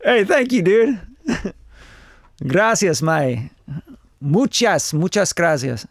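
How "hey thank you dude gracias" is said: ordinary talk, nothing marked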